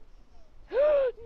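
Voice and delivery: loud shocked high gasp; startled gasp; sharp gasp. Real, not acted. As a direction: gasp as if stung. Sudden wasp sting.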